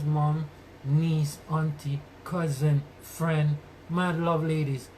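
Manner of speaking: ordinary talk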